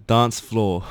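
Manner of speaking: "dance floor" with a long British ah and slow delivery. Shown as a demonstration. Guest demonstrating the British way to the host, British "dance floor". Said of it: "美国是dance floor".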